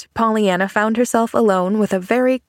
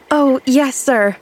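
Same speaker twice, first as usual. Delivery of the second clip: bright, eager, louder yes; beaming; 5 semitones higher, 4 dB louder, slower than usual. A narrator's reading. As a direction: answer with a glowing delight. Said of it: "beamed Pollyanna".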